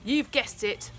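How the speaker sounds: ordinary delivery, nothing marked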